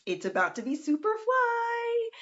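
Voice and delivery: pitched far up with excitement; hyped up. Real, not acted. Hyping the lake house retreat to viewers, high excited. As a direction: sell it, voice jumping high.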